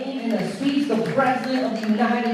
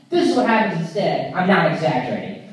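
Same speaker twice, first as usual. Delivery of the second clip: ordinary talk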